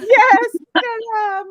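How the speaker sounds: high, loud delight at the dog; light up at her name; excited greeting. Real, not acted. Owner greeting her dog Stella, high and loud.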